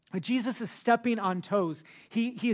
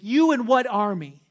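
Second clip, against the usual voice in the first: louder mocking challenge in a put-on voice; taunting; 4 semitones higher, 9 dB louder than usual. Pharisees taunting Jesus, loud mocking challenge. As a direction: taunt him like a schoolyard bully. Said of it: "essentially is what they say".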